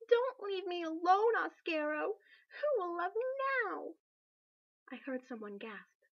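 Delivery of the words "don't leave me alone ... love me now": high, loud, despairing wail; a queen wailing over her unconscious husband. Acted. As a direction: cry out in grief and self-pity. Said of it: "Ivy wailed".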